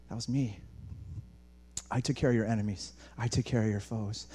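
low, softened, measured voice of God; quiet reassurance. Acted. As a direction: drop down low and state it calmly. God's quiet reassurance after reminders.

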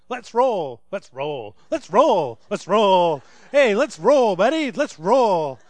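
put-on two-year-old voice. Pastor imitating toddler repeating his words, toddler chant. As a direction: parrot it back like a little kid chanting. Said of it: "this two-year-old little voice behind me going".

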